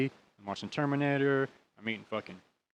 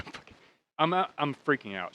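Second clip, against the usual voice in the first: usual speaking voice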